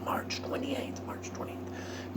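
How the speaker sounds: slow, ghostly whisper; imagined whisper. Pretend. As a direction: breathe it like a secret prompter behind him. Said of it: "standing behind me going".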